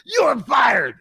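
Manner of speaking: high, loud furious yell; furious shout. Retold or imitated. Red-faced boss firing him, furious shout. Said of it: "all he could get out was".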